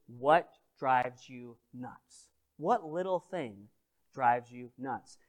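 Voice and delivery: drawn-out slow question. Real, not acted. Preacher asking the congregation, slow and deliberate. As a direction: ask it slowly and let it land. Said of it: "if I asked you".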